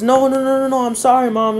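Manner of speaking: high, loud, panicked pleading; panicked apology. Acted. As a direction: blurt the sorry in a rush. Broke door, mom upset.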